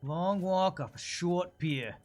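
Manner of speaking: put-on slow, drawn-out New York accent. Gamer muttering a slow New York accent. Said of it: "a New York accent".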